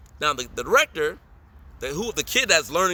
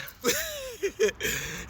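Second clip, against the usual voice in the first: high laugh; 6 dB quieter than usual. Real, not acted. Laughing at own story.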